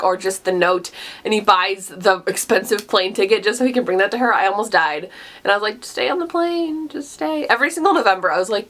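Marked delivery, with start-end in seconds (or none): 5.8-7.4 high, soft, slow plea; half-wistful imitated plea; pleading. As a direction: beg them gently to stay. Pleading with the characters.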